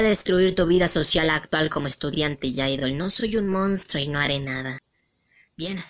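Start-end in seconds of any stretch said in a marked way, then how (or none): none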